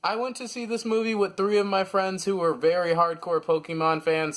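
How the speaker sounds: ordinary talk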